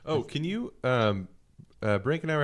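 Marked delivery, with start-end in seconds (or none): none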